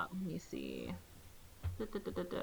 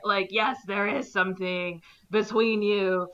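usual speaking voice

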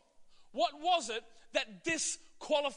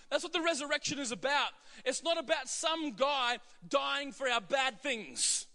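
ordinary talk

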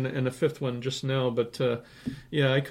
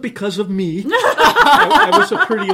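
words broken by a loud laugh; 9 semitones higher, 12 dB louder than usual. Real, not acted. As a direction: laugh through it, self-mocking. Laughing at his own McLuhan joke.

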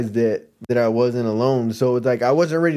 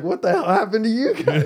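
pitched up, laughing; mock bewildered crowd; amused onlookers' voice; 9 semitones higher than usual. Diners seeing the drenched guys burst in, laughing bewilderment.

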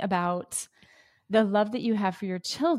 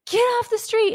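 sharp alarmed yell, much higher and louder; yelling at kids; 14 semitones higher, 5 dB louder than usual. Retold or imitated. Mother yelling at kids in the street, alarmed shout. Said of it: "and I yell at them".

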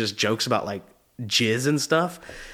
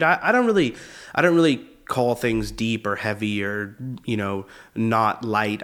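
ordinary delivery

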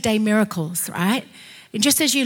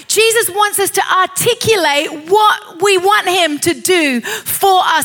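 high, slow, forceful exhortation; full-voiced pulpit urging; impassioned preaching; 9 semitones higher, 5 dB louder, slower than usual. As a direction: stretch the key words and drive them home.

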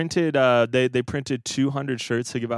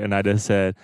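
normal talking voice

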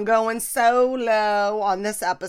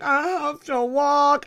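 imitating Mom, high, loud, slow whine; mock a pouty complaint, dragging it out; 7 semitones higher, 7 dB louder than usual. Daughter imitating her mom about walking a block, pouty. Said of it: "she gets a little pouty".

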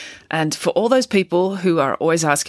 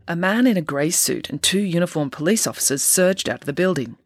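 plain reading voice

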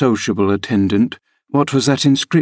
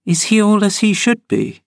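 put-on much higher girl's voice, earnest; serious young girl; 11 semitones higher than usual. A narrator's reading. Cathy asking Linton about Hareton, serious high voice. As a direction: ask it in earnest. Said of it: "asked Miss Cathy seriously".

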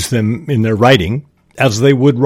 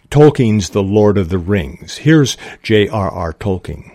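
usual speaking voice

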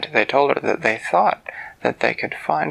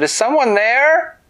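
high, drawn-out questioning call; 13 semitones higher, 4 dB louder than usual. Acted. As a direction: call slowly into the woods to find who is there. Winona calls to unseen voice.

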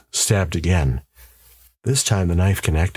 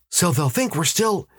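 higher, insistent husband's voice; urgent husband; 12 semitones higher than usual. A narrator's reading. Husband warning his wife of a powerful enemy, urgent. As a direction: press the warning on a disbelieving wife.

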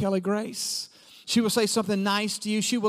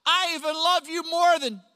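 put-on little boy; 7 semitones higher than usual. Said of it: "He said".

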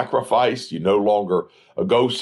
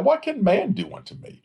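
defiant rhetorical question; 12 semitones higher than usual. Acted. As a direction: float it up high like a dare nobody can answer. Repeats verse as bold challenge.

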